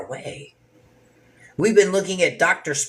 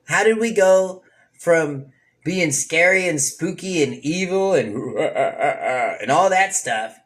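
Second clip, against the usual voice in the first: mock spooky voice; 2 semitones higher, slower than usual. Mocking the spooky Halloween season.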